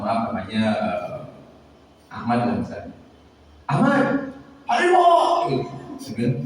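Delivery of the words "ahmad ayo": student yelling. Retold or imitated. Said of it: "Teriak-teriak terus".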